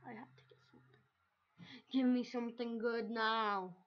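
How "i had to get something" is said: whispered to himself. Real, not acted. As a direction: breathe it out as a whisper. Muttering to himself.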